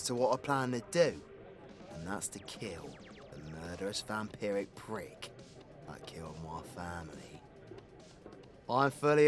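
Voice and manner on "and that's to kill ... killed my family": low, hushed menace; grim vow. Acted. Vowing to kill the family's murderer, quietly grim.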